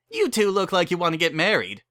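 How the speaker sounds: high, loud, eager wedding-chapel host; cheery chapel keeper. Acted. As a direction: sell them a wedding with pushy cheer. Barry pushes marriage.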